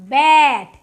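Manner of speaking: ব্যাট said loud as one clear word, short a like bat; teacher's model word. Shown as a demonstration. Teacher models ব্যাট for pupils, loud and clear. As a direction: model ব্যাট loudly for the class. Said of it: "বিতে ব, এতে অ্যা, টিতে ট".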